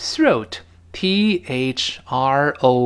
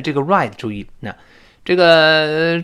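ordinary talk

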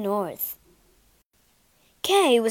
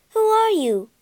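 much higher and louder, alarmed; startled cry; 11 semitones higher, 6 dB louder than usual. Acted. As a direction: cry out, suddenly woken and startled. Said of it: "she cried".

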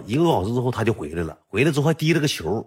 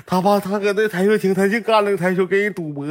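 put-on higher-pitched tattletale kid; tattling; 6 semitones higher than usual. Boy telling his bully's grandparents about the gambling, tattling. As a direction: tell on him in a raised, insistent pitch.

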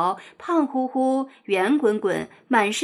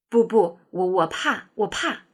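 mildly fearful, halting stammer; hesitate, repeating words nervously; understated anxious pleading; afraid; 2 semitones lower than usual. A narrator's reading.

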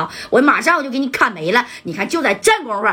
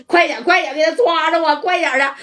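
urgent orders; 7 semitones higher than usual. Retold or imitated. Boss urging men to chase.

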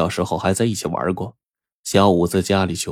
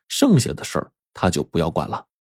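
ordinary delivery

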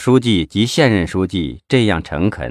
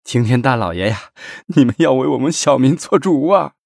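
put-on higher, pleading old man; tearful plea; 5 semitones higher than usual. A narrator's reading. Old man begs officials for justice, tearful plea. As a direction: plead through tears, voice lifting at the end. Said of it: "老人老泪纵横".

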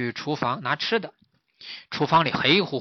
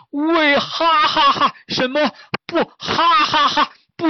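high, loud, slow words broken by laughter; helpless laughing; 13 semitones higher, 10 dB louder, much slower than usual. Acted. Master turning into a laughing man, speech broken by loud laughter. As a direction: laugh uncontrollably mid-sentence.

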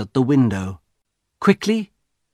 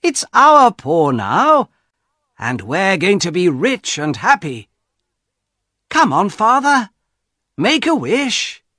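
higher, louder eager urging; eager young son; 9 semitones higher, 5 dB louder than usual. Acted. Son urging his father to wish, eager and high. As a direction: push Father along like an excited young man.

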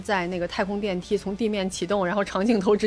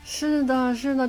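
normal talking voice

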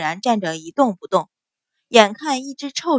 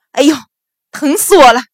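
loud, high-pitched yowl; pained howl; wailing in pain; 4 semitones higher, 9 dB louder, faster than usual. A narrator's reading. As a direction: cry out in agony. Officer's wrist crushed, cries out.

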